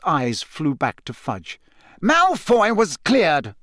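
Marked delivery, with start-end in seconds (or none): 2.0-3.5 higher, slow affronted retort; offended and indignant. A narrator's reading. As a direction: take offence, stretching it out. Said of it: "visibly affronted".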